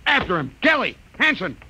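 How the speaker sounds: high, loud calls; shouted orders. Acted. Captain calls his crewmen to chase Danton, loud shout. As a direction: summon the men urgently.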